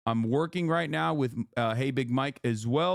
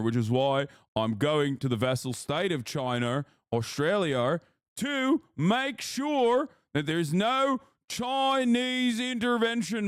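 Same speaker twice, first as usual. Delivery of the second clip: bad Aussie accent. Streamer doing a bad Australian accent for chat. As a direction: ham up a cartoon Aussie patriot. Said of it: "[name] has the worst Aussie accent".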